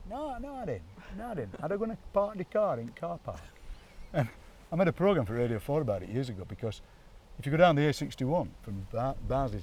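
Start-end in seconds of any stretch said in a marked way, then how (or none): 0.1-3.4 higher, softer accent with wide A. Shown as a demonstration. Demonstrating the regional wide A, high-pitched caricature accent. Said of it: "a wide A".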